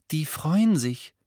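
put-on high, slow, happy girl's voice; Luna, pleased. A narrator's reading. Luna telling Harry about the Ravenclaws, pleased. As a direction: remark slowly in high-pitched delight.

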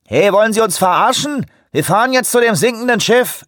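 high, loud angry radio voice; angry over walkie talkie. A narrator's reading. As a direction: bark it back over the radio. Angry ship radio reply.

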